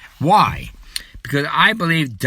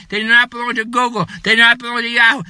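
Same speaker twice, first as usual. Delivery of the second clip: ordinary delivery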